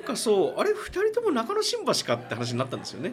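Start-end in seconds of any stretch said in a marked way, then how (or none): 0.6-2.1 high surprised realization. Retold or imitated. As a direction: sound suddenly surprised. Retold surprised remark.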